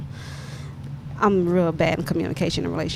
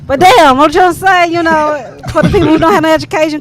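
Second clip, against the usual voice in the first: raised, urgent voice; loud and emphatic; 7 semitones higher, 9 dB louder than usual. Real, not acted. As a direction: push it out loud and fast. Emphatic interjection in discussion.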